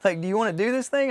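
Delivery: high, loud imitation of friend insisting; animated retold insistence; pressing the pitch.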